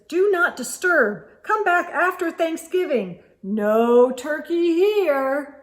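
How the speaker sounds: turkey bluffing. A narrator's reading. Turkey pretending absence.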